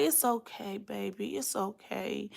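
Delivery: hushed, barely-there reassurance; soothing. Retold or imitated. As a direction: hush her gently like a sick friend. Wrapped-up patient soothing her.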